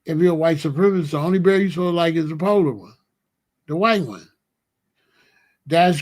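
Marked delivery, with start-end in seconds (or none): none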